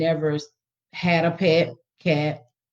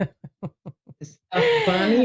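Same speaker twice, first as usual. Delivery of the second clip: teacher's delighted surprise; 4 semitones higher than usual. Acted. Teacher to a pretend student, high and amazed. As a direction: coax the child on with big surprise. Teacher questions child in class.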